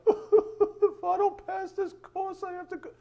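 hammed-up squeaky begging to the teacher; pleading student. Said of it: "the student comes up at the end".

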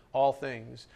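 ordinary talk, nothing marked

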